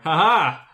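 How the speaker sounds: a loud, hammed-up "ha ha" of triumph; loud mock victory laugh; mock gloating laugh. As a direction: gloat like a cartoon villain who just made you discard. Gloating over old discard spell.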